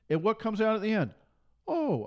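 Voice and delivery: normal talking voice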